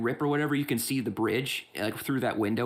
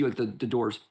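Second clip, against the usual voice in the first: ordinary talk, nothing marked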